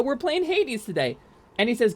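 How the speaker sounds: ordinary delivery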